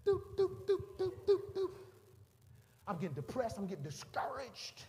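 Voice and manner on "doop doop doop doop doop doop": high, steady checkout beep; high-pitched electronic blips by mouth; imitated scanner beeps. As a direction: mimic a register scanner, "doop, doop". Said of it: "those new beeper checkouts".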